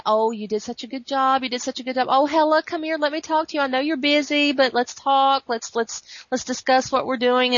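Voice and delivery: high, singled-out mock praise and coaxing; imitated villain's wheedling taunt; Mephisto taunting. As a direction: needle them with fake friendliness. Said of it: "he's kind of taunting".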